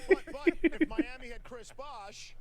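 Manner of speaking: loud, high, drawn-out pundit outburst; Stephen A. Smith. Acted. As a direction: blast it out slowly like an outraged sports pundit. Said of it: "How would Stephen A. Smith say it".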